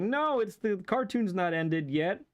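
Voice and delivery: high, squawky cartoon duck protest; Daffy Duck. Fan retelling the ending, Daffy's high frantic protest. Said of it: "and he's like".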